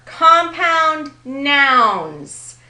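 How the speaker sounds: COMPOUND NOUNS, loud and drawn out; big, slow stress on compound nouns; emphatic key term. Real, not acted. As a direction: slowly hammer both words, compound and nouns.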